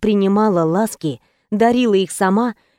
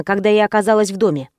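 usual speaking voice

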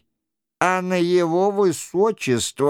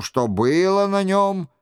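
usual speaking voice

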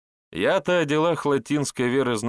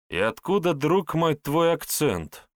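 higher, probing question; suspicious; 8 semitones higher than usual. A narrator's reading. Andrey questioning the herbalist's accent, pointedly suspicious. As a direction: draw out the last words with suspicion. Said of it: "прищурившись, спросил Андрей".

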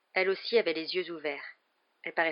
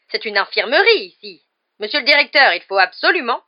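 loud, high indignant nurse; furious protest to the headmaster; enraged; 6 semitones higher, 14 dB louder than usual. A narrator's reading. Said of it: "folle de rage". (folle de rage)

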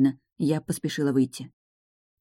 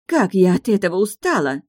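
loud, higher, fed-up outburst; exasperated; 4 semitones higher, 5 dB louder than usual. Acted. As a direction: shout it out in frustration. Fiancée quarrels.

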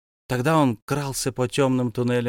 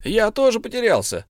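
high, raised impatient cry; impatient shout; 10 semitones higher, 4 dB louder than usual. A narrator's reading. Bilbo shouts impatiently at Gollum. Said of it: "закричал в нетерпении Бильбо".

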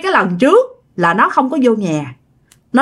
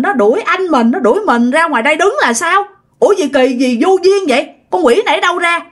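higher-pitched indignant retold grumbling; irritated; 6 semitones higher than usual. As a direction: act out a worked-up, indignant complaint. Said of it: "chồng của bạn mới quạo lên". (giọng bực bội, quạo, nhái lại)

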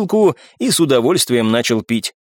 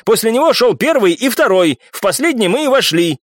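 irritated lecture pitched up; indignant shouting; 8 semitones higher than usual. A narrator's reading. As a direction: argue in a raised, exasperated pitch. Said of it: "не менее громко прокричал Виконт".

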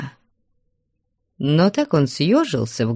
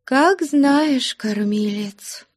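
high, trailing, pitiful voice; plaintive; 10 semitones higher, much slower than usual. A narrator's reading. Wife leaves the decision to her husband, plaintively. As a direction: defer in a small plaintive voice. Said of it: "жалобно промолвила Аксинья Захаровна".

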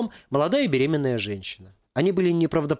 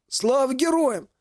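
hoarse veteran greeting; 11 semitones higher, slower than usual. Acted. Legless veteran greets loudly.

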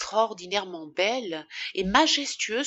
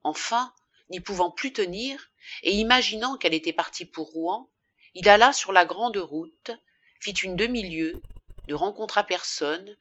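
ordinary delivery

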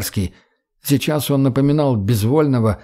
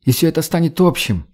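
higher-pitched, eager character voice; earnest young woman; 4 semitones higher than usual. Acted. Redhead pitching coexistence to the boy, eager conviction. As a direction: persuade with conviction, pitch raised.

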